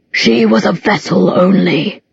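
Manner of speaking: slow, hollow, threatening demon; an ancient demon boasting, slowly; menacing demon voice. Acted. Demon Abaddon speaks.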